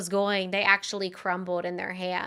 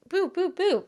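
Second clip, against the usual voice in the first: high bouncy boop noises; imitating thrashing; 10 semitones higher than usual. Retelling to her co-host how she thrashed, high mock boops. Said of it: "She said I looked like".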